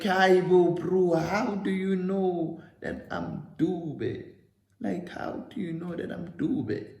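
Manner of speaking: high, loud, slow disbelieving question; stunned, drawing it out; shocked disbelief. Retold or imitated. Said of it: "Because I'm shocked by the whole situation".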